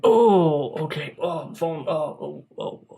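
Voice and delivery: hammed-up fake sobbing, breaking off into whimpers; overdramatic crying. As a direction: pretend to be moved to tears for the camera. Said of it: "Trying to be over dramatic".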